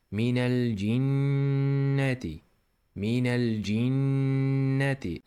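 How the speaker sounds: minal jinnati, slow, with a nasal nn; ghunna. Shown as a demonstration. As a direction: hum the nn in jinnati through the nose. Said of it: "let the sound vibrate in your nose".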